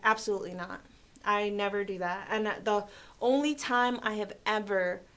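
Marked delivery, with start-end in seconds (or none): none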